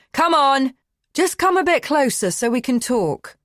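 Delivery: loud, urgent call; shouting. Acted. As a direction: shout it to make her stop. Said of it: "He shouts again".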